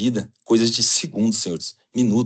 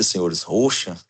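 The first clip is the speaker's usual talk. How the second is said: ordinary delivery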